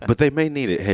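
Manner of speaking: ordinary delivery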